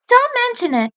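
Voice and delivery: put-on high young female rabbit voice, light and kind; kind sister voice. Sister answers Nick's thanks, high kind voice.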